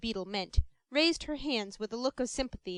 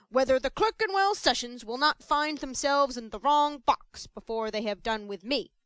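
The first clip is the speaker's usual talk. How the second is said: loud, swelling self-importance; pompous beadle; 6 dB louder than usual. Acted. Mr Bumble boasting to Mrs Mann, loud and pompous. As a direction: puff yourself up and declaim.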